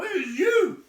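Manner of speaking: loud, high accusation; angry troll. A narrator's reading. Tom accusing William, loud and high. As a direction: jab the blame at him. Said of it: "said Tom".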